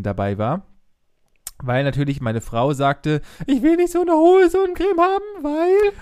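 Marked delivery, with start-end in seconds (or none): 3.5-5.9 high squeaky imitation of a woman; man doing a woman's voice. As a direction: mimic a fussy wife, pitched way up. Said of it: "eine allgemeine Frauenstimme, die ich gerade hier gerade nachgeäfft habe". (nachgeäffte Frauenstimme, hoch und quäkend)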